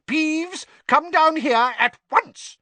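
high, loud, irate command; angry yell. A narrator's reading. Teacher orders the poltergeist down from above, angry yell. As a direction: yell it up at a troublemaker, high and furious. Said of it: "yelled an angry voice".

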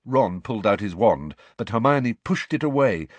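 ordinary delivery, nothing marked

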